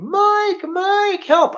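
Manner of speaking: very high, loud mocking cry for help; mock screaming. Dropped to the ground calling his friend, mock high scream. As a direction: imitate a panicked man screaming for rescue. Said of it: "started screaming".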